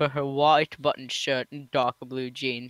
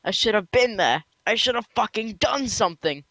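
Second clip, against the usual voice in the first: raised, higher-pitched regret; anguished self-blame; 7 semitones higher, 3 dB louder than usual. A narrator's reading. Tom blaming himself for his friend's death, higher anguished outburst. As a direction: let grief push the pitch up. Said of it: "he felt his eyes welling up".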